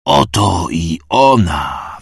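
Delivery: slow, drawn-out cry; the priest cries out to the worshippers, slow and loud; shouted proclamation. A narrator's reading. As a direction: cry it out to the crowd. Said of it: "wykrzyczał wypalony".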